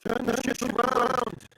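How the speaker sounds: shouting orders. Acted. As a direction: yell it across the deck. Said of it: "shouts out to the".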